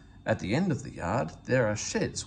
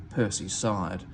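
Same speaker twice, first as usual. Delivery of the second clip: ordinary delivery, nothing marked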